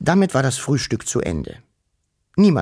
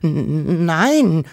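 ängstlich gestottert, lauter; stotternd; 6 semitones higher, 5 dB louder than usual. Acted. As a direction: stockend vor Angst. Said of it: "stotterte er". (Wuschel stottert verängstigt vor dem Vater)

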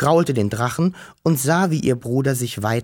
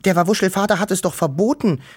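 higher and fast, a mild whine; whining; 7 semitones higher, faster than usual. Acted. Wischel reminds her brother of the father's ban, mildly whining. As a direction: plead it like a worried little sister. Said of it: "jammerte Wischel".

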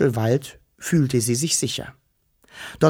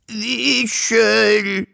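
high, drawn-out wailing call; plaintive calling for a lost friend; sorrowful whimper; 9 semitones higher than usual. A narrator's reading. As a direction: whimper the name, full of grief. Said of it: "wimmerte der Drache kummervoll".

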